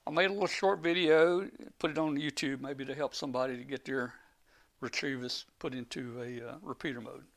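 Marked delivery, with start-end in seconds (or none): none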